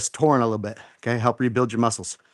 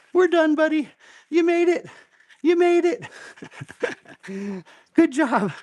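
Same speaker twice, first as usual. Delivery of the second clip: much higher, elated "we're done"; high excited cheer; 13 semitones higher, slower than usual. Real, not acted. Coach celebrating with buddy Andy after the set, excited high cheer. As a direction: cheer the finish like you just survived it.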